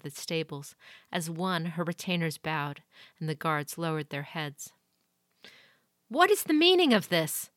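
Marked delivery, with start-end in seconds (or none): 6.1-7.5 sharp, much higher, loud demand; lady of the castle demanding answers. A narrator's reading. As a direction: stop the commotion at once. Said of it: "she demanded".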